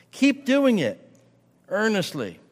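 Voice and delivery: pitched high and pressing, then dropping to the last word; high urging. Acted.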